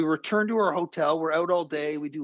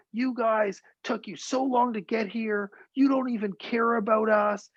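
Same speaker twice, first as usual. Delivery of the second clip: retold complaint, pitched higher and slowed; upset evacuee; 6 semitones higher than usual. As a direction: voice the angry, hurt resident with a rising pitch. Upset evacuee confronting the reporter.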